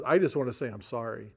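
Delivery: usual speaking voice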